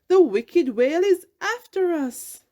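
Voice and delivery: high and loud with panic; alarmed. A narrator's reading. Jagged-finned fish warning the school, panicked. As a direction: raise the alarm. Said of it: "sounded the alarm".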